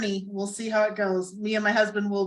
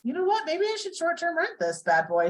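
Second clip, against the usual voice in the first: playful inner thought; 8 semitones higher than usual. Retold or imitated. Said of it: "I thought".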